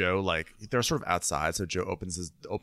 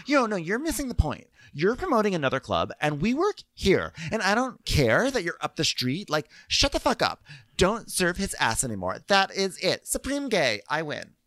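higher, fired-up imitation of a furious retort; mock angry rant; 11 semitones higher than usual. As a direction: rant it at a raised pitch, half-joking.

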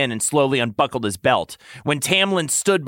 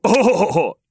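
short bright laugh, higher and louder; 6 semitones higher, 5 dB louder than usual. Acted. Lucien laughing at Tamlin's claim. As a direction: let out a quick amused laugh. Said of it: "Lucien laughed".

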